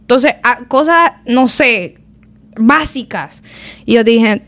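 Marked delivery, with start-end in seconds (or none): none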